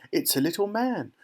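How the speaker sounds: put-on thrilled little-boy voice; excited boy's cry. A narrator's reading. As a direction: pipe up eagerly as a young boy. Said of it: "cried Charlie".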